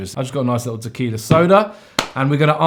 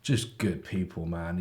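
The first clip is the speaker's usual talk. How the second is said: sincere; 7 semitones lower, 12 dB quieter, slower than usual. Real, not acted. Sincere praise.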